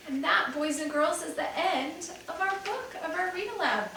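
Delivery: teacher telling class the book ends, cheerful; teacher wrap-up. Real, not acted. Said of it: "teacher closing the read-aloud".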